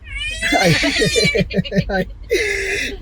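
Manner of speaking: big shrieking laugh; squealing laugh; high squealing laugh. Real, not acted. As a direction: burst out laughing.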